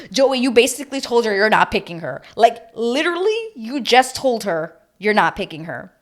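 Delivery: loud, emphatic mock telling-off; exasperated scolding. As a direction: scold him loudly in disbelief.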